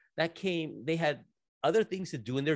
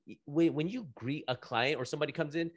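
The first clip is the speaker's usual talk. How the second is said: normal talking voice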